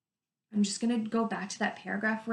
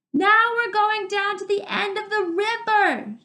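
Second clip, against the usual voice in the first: much higher and louder, calling over water; shouting over the river; 11 semitones higher, 7 dB louder than usual. Acted. Arlen shouting to Dune as they walk by the river. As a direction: shout the plan to him.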